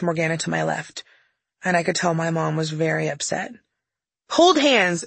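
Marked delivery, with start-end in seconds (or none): 4.3-5.0 mom's high, loud command; sudden yell. Acted. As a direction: burst out with a sharp order. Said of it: "she suddenly yelled".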